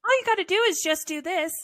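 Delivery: smug mock instruction. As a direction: toss it off like it's so easy.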